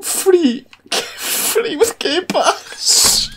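high squeal breaking into laughter; giggling fit. Real, not acted. Player reading out the Game Pass offer, giggling helplessly. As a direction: squeal the words out through real giggles. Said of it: "I've just got the giggles".

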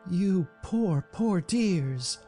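high-pitched, cooing pity; sympathetic cry. A narrator's reading. Mercedes pitying whipped dogs, sympathetic high cry. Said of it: "she cried sympathetically".

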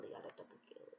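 voiceless whisper. Acted. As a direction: hush it like a secret. Repeats in varied ways.

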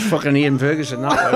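bursting out laughing, then loud laughing words; real laughter. Real, not acted. Remembering his teammate's prank, he laughs out loud. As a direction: laugh hard through the name. Recalling the sash story.

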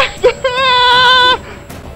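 high panicked laugh; nervous laugh. Real, not acted. Laughing while attacked.

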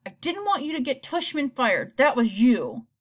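put-on loud accusing kid; angry accusing. A narrator's reading. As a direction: blame her hotly. Boy accusing his mother.

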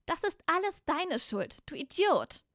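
high, drawn-out angry accusation; angry Hermine. Acted. Hermine blames Malfoy for the disappearance, high and angry. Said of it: "wand sich Hermine wütend zu Malfoy um".

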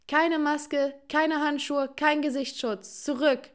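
high, loud, staccato orders; screamed warning. A narrator's reading. Mother aiming bat at unmasked children, screamed warning. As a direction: shout each item, driving them back. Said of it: "schrie sie".

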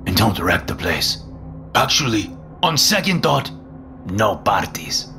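put-on high, swinging pitch with thick accent; Dragon, chummy landlord. A narrator's reading. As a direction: play a pushy, friendly foreign-accented landlord. Landlord's voice in story.

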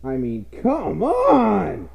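drawn-out, slow "come on"; slow comic exasperated whine. Acted. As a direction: stretch it out in exasperation. Exasperated at system requirements.

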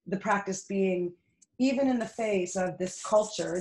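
usual speaking voice

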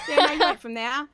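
amused denial through a laugh. Real, not acted. Woman laughing as she rejects his claim, laughing.

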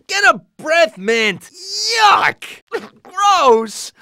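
high, drawn-out revulsion; grossed-out high squawk; disgusted. Acted. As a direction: recoil from a foul smell. Recoils from foul breath.

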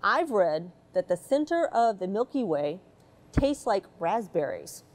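plain reading voice

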